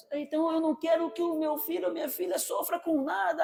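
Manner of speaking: high-pitched mock overprotective mother; overprotective parent imitation. Preacher mimics an overprotective mother, lightly mocking. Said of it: "aquela super proteção".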